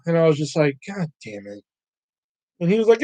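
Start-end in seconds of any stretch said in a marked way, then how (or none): 0.9-1.6 soft, resigned curse under the breath; defeated grumble. Retold or imitated. Retelling his reaction to a bad prank joke, defeated grumble. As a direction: let it drop quietly, fed up.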